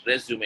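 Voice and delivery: RESUME in three syllables, ending in "may"; correct pronunciation. Shown as a demonstration. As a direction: say RESUME as "REZ-yoo-may". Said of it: "is correct".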